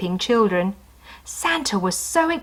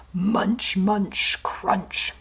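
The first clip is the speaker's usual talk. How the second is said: munching; 3 dB quieter, much slower than usual. Acted. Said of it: "went the reindeer".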